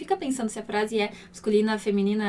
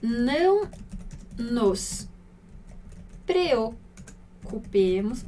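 slow spaced reading; much slower than usual. Shown as a demonstration. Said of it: "Então".

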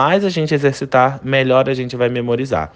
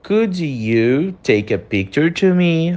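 slow, careful question, "could" leading; slow English model sentence; 4 semitones higher, slower than usual. Shown as a demonstration. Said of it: "Então, o could". (pergunta em inglês devagar)

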